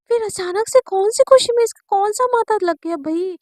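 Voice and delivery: puzzled outcry, mildly hammed-up; baffled outburst. Wondering what suddenly went wrong, baffled outburst. बदले mood पर हैरानी.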